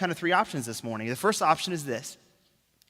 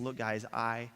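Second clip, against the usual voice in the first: low hushed claim; 6 semitones lower, 10 dB quieter, slower than usual. Retold or imitated. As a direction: lower and softer, slow on am divine. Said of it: "him saying".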